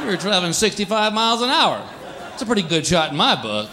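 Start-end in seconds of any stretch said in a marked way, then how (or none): none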